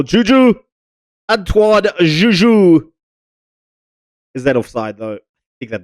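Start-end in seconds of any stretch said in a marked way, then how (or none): 0.0-2.9 high, drawn-out name call; excited goal call. Acted. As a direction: stretch the scorer's name out. Goal commentary celebration.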